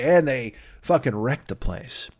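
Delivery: usual speaking voice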